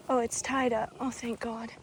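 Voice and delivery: usual speaking voice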